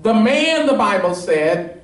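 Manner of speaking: high, ringing preacher's climb, pressing each point; preaching at full force. Acted. Pastor pressing the congregation on the leper's "If", fervent preaching. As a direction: drive the point home to the congregation.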